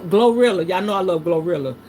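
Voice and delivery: ordinary talk, nothing marked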